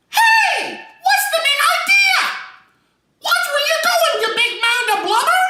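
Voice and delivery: very high, loud squeaky shout; a tiny high-pitched creature yelling. Acted. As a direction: shriek it at the top of the range. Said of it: "let me try that on number one".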